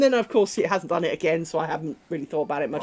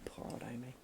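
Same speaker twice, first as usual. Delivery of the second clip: whispered, much softer aside; pitying whisper; 8 semitones lower, 21 dB quieter, faster than usual. Real, not acted. Aside to viewers about her dog, soft pitying whisper. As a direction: breathe it, half to yourself.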